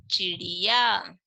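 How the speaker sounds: चिड़िया blended into one word, said slowly and clearly; slow whole-word demo; slow blended reading. Shown as a demonstration. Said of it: "क्या बना?".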